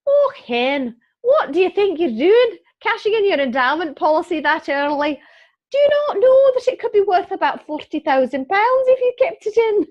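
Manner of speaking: hammed-up Scottish accent, loud and high-pitched; very bad Scottish accent. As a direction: imitate an aghast caller in an exaggerated, badly done Scottish accent. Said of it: "That is my very bad Scottish accent".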